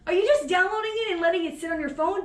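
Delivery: high-pitched, incredulous questioning; mock exasperation. Podcaster confronting silent listeners abroad, mock exasperation. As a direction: plead in playful outrage.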